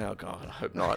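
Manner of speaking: low, soft, deadpan mutter; grumbling reply to the neighbor; dry muttered aside. Retold or imitated. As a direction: say it under your breath. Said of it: "I'm like".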